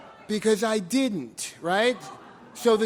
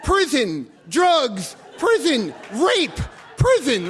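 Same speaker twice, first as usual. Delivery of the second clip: loud chanted warnings, each word hammered; mock alarm chant, louder and a bit higher; flashing sign shouted; 4 semitones higher, 5 dB louder, slower than usual. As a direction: yell it like a neon alarm blinking. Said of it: "And they're flashing, and it says".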